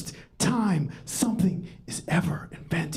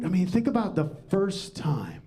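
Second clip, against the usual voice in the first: ordinary talk, nothing marked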